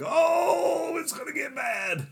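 very high drawn-out 'oh' of excitement; hyped-up dread. Retold or imitated. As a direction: squeal it like a thrilled fan. Fan hyped as lightsaber ignites.

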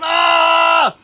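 long, loud, high mock scream; imitating a friend howling as it squeezes; retold wail of pain. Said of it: "it's squeezing tighter".

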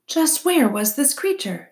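fox character. A narrator's reading. Fox questions the owl about the creature, pointed.

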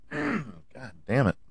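losing his voice, hoarse and strained; rough, fading voice after coughing. Real, not acted. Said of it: "Sorry, I'm losing my voice here".

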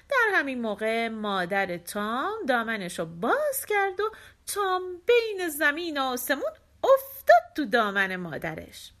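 usual speaking voice

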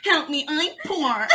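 high, put-on silly plea; nutcase act. Clowning for the intercom camera, nutcase plea. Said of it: "just being an absolute nutcase".

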